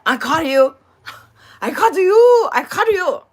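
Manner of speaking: loud, high imitated 'gotcha' outburst; gleeful owner catching culprit. Store owner to the customer caught out, imitated gleeful accusation. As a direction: crow it in mock accusation, drawing out the end. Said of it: "He goes, I'm gonna catch her".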